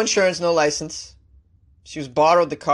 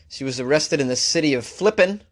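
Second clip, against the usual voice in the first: ordinary talk, nothing marked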